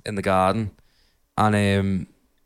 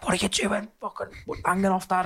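mum telling off; 11 semitones higher than usual. Retold or imitated. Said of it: "she was like".

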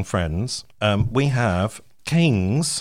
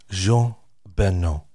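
put-on French accent; slower than usual. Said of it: "Can we do it in a French accent?".

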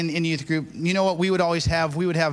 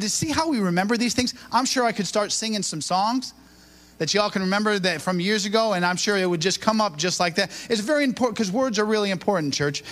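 ordinary talk, nothing marked

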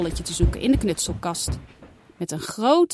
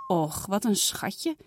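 softer, slower and melting; tenderly; 5 dB quieter, slower than usual. Acted. Mama seeing the kitten, soft tender coo. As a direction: coo over the tiny kitten. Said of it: "zegt mama vertederd".

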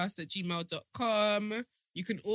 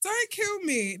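high-pitched plea after a laughing fit; 12 semitones higher, much slower than usual. Real, not acted. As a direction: still giggly, pitched well up. Still recovering from laughing fit.

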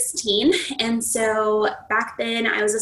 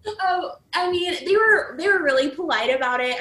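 ordinary delivery

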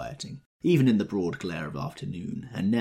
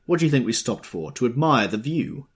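sarcastic; 6 semitones higher, 8 dB louder than usual. A narrator's reading. Tom scorns Wilson.

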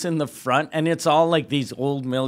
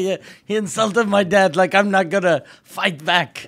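loud, raised-pitch aggrieved fighter; Khabib; 5 semitones higher, 6 dB louder than usual. Acted. Fighter defending himself over insulted dad, mock aggrieved. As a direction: justify yourself in mock indignation. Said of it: "He's like".

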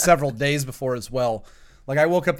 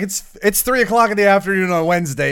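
mock bartender; 6 semitones higher, 5 dB louder than usual. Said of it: "he's like".

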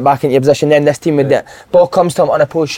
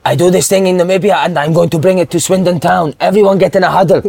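imitated Namibian accent, slightly higher; 4 semitones higher than usual. New player addressing the team before first game, Namibian accent. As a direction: announce it in a put-on Namibian accent. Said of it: "this guy, mate".

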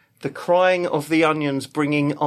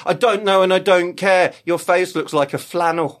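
rude onion retort; 5 semitones higher, 6 dB louder than usual. Retold or imitated. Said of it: "They'd probably go".

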